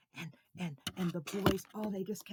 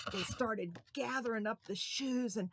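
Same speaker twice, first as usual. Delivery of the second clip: usual speaking voice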